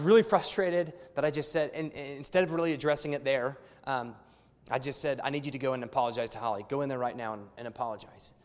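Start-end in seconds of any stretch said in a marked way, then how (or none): 5.2-8.3 quiet firm order. Retold or imitated. Firm command to son.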